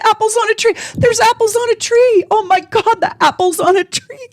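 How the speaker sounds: near-sobbing wonder at the apples; overwhelmed joy. Retold or imitated. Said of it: "I was like".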